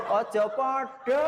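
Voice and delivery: drawn-out shout so the letters are not said alike; slow stern call. Real, not acted. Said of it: "La, la".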